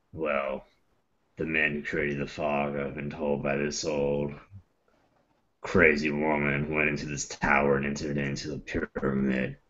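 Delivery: low, quiet gravel-free rumble; low gruff voice. Acted. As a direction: speak low and steady as the character. Character speech, low voice.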